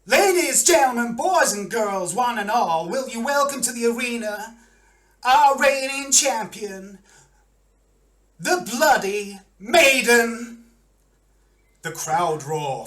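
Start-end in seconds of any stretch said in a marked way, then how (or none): 0.1-10.6 high, booming champion introduction; arena announcer. Acted. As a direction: bellow to the crowd, stretching out the champion's title. Announcer introduces champion over vox.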